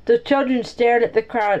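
ordinary delivery, nothing marked

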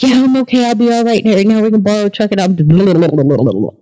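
high-pitched nervous rattling; talking real fast. Retold or imitated. Nervous passenger reassuring the driver, rattling real fast. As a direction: rattle on until it dissolves into babble. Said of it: "I'm talking real fast".

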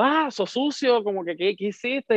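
high-pitched, playful outrage; mock indignation. Confronting the friend who took his wallet, mock indignant.

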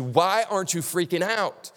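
high, puzzled questioning of a calm friend; amazed asking. Retold or imitated. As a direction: ask it in wide-eyed disbelief. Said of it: "people have to come and ask you".

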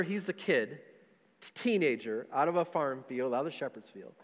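normal talking voice

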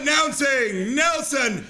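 high, slow herald call; town crier. Shown as a demonstration. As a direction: announce the guest grandly. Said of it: "I'm practicing my announcing skills".

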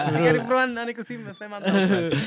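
high, loud and laughing through the words; laughing mimicry. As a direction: giggle while mimicking the cousins.

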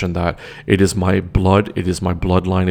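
ordinary talk, nothing marked

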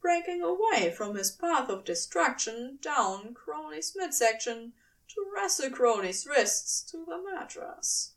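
usual speaking voice

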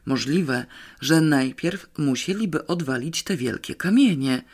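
ordinary delivery, nothing marked

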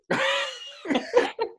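high, breathy burst of laughter. Real, not acted. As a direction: laugh it off warmly. Laughs at being ordinary.

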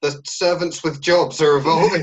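eager correction. Retold or imitated. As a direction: chirp the fix brightly. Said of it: "she's like".

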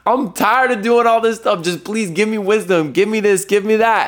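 a hint of a high, tired plea; pleading with God. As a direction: beg God to end the struggle, lifted high. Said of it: "screaming at God".

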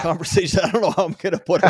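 amused at how hard it is to title this talk, laughing; laughing while talking. Real, not acted. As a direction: laugh your way through the line.

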